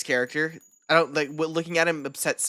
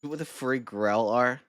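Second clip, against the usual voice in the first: ordinary talk, nothing marked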